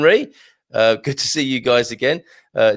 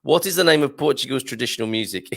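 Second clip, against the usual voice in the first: ordinary delivery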